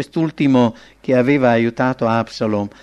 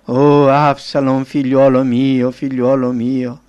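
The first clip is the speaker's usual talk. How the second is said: slow, loud keening of a grieving father; mournful lament; 2 semitones higher, 6 dB louder, slower than usual. A narrator's reading. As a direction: cry out the son's name, drawn out, easing at the end. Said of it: "si sentiva questo lamento". (lamento funebre, lento e a voce forte)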